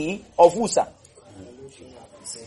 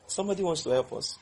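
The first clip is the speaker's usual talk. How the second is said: normal talking voice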